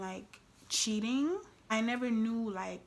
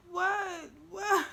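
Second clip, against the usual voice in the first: high, mock-tearful whine; imitated crying; 12 semitones higher, slower than usual. Girlfriend caught cheating plays innocent, mock crying. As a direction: wail it like a caught big baby. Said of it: "And I'm crying, and I'm like".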